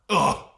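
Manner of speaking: loud huffy "ugh"; exasperated groan. Retold or imitated. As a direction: groan in put-on annoyance. Acting exasperated reaction to mask request.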